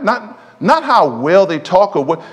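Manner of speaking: ordinary talk, nothing marked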